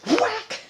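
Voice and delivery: sharp high whack sound effect; imitated whack. Telling how someone busts him in the head, a sharp high whack. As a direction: smack the word like a hit.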